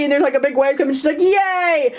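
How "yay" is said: mock cheerful cheer. Happy sister again.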